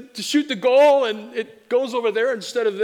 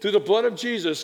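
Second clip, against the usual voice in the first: ordinary talk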